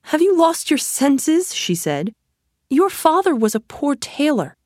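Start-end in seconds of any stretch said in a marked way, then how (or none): 0.1-1.5 high-pitched, scoffing disbelief; much higher voice, as a mother mocking a wild idea; incredulous mother. A narrator's reading. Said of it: "Aladdin's mother laughed". 2.7-4.6 high-pitched, scoffing disbelief; much higher voice, as a mother mocking a wild idea; incredulous mother. A narrator's reading. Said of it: "Aladdin's mother laughed".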